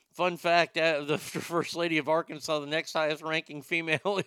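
ordinary talk, nothing marked

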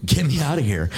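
high-pitched urgent outcry; urgent plea. Retold or imitated. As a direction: cry it out high, desperate to leave. Repeats the wish as an outcry.

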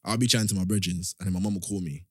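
ordinary talk

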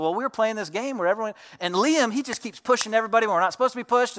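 put-on high, fast kid explaining in a rush; tattling kids. Cousins telling their uncle about Liam's pushing, high rushed tattling. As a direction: pipe up like a child telling on a cousin.

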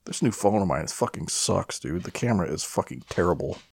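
usual speaking voice

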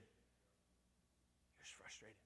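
slow breathy whisper; whispered aside, drawn out. Shown as a demonstration. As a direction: drop to a whisper on the repeat.

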